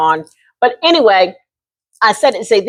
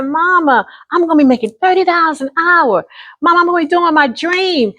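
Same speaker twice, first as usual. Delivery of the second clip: higher, begging voice to mama; pleading teen; 8 semitones higher than usual. Retold or imitated. Teen daughter begging her mother to allow modeling, higher pleading. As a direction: plead like a hopeful teenager.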